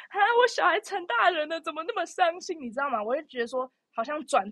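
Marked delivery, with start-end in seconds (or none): 0.1-2.6 high, hammed-up sad parent; playfully over-sad parent; mock parent lament. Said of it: "你不会说".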